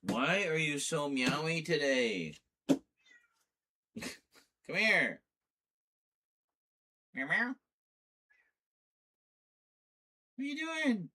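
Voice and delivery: very high, cooing pet voice; baby talk to cat. Acted. Streamer cooing at her meowing cat in high baby talk.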